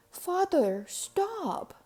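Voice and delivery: sharp high call to stop him; shouted. A narrator's reading. Son stops his father dumping the dough, high shout. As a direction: cry out to stop father in time. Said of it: "shouted Walter Junior".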